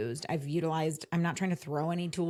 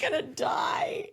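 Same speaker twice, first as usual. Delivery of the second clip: laughing despair; 10 semitones higher, much slower than usual. Real, not acted. Laughing despair at support email.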